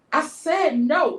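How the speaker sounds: acting out a friend putting her foot down; firm refusal. Retelling friend's refusal before slap.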